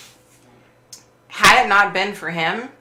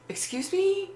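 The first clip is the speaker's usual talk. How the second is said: much higher, softer incredulous question; shocked nurse; 13 semitones higher, 8 dB quieter than usual. Retold or imitated. New nurse hearing the patient's story, in high shocked disbelief. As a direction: gasp it out like she's stunned.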